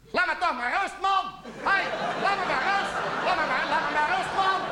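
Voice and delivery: high, desperate cry to be left alone; harassed man yelling. Acted. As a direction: shout him off your back. (wanhopig geschreeuw van een achtervolgde man)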